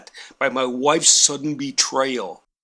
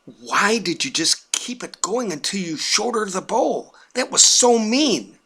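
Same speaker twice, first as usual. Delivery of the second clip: higher, exasperated complaint; rising into indignation; aggravated; 7 semitones higher than usual. Retold or imitated. Husband scolding wife over the bowl, aggravated. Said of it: "totally aggravated".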